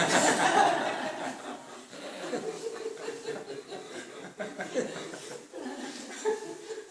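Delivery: high breathy burst of laughter; big laugh. Real, not acted. Teacher cracks up at his own fan joke, laughing hard.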